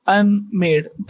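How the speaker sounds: "unmade" slowly, as un, then made; syllables separated. Shown as a demonstration. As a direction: teach "unmade" in two separate beats. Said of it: "ऐसे बोला जाएगा". ("unmade" धीरे, दो हिस्सों में तोड़कर)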